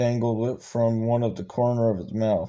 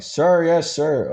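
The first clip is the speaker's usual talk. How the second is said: raised, higher-pitched recruit's answer; loud response; 5 semitones higher, 5 dB louder, slower than usual. A narrator's reading. Recruit answering his drill instructor, loud and obedient. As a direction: call it out louder, starting high. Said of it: "Hathcock responded loudly".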